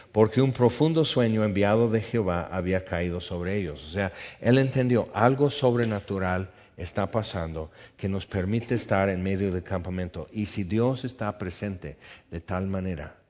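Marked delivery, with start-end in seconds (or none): none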